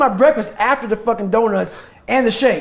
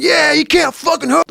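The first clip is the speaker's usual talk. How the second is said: mock yell, pitched well up; retold yell; 9 semitones higher than usual. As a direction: shout it like a crew member hyping the boat team. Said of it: "you're yelling".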